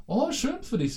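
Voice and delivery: very high, slow sing-song "Oh, schön für dich"; played-up fake joy. Showing the played-up reply, high and slow. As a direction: stretch it high and syrupy, clearly fake. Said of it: "dieses gespielte".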